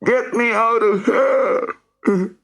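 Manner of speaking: put-on high, slow tearful boy's plea; crying plea. Rory crying to Sam from inside the hole. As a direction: beg through tears. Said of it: "Rory was crying".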